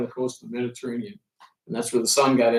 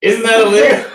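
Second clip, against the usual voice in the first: higher and louder, laughing through it; laughing disbelief; 7 semitones higher, 11 dB louder than usual. Retold or imitated. Pilot asks the son if buzzing is legal, laughing. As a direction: giggle out the question. Laughing at offer to buzz pyramids.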